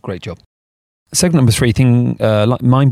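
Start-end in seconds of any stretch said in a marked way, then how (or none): 0.0-0.4 calm, paced breathing guide; guided breathing. Acted. Leads a breathing exercise.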